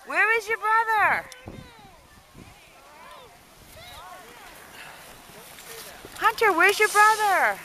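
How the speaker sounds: high singsong calling. Real, not acted. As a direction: call out, wondering where the brother is. Playful calling question to child.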